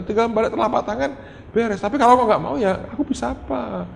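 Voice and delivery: high-pitched, insistent acted plea; helpless pleading. Father telling God it is all up to Him, pleading.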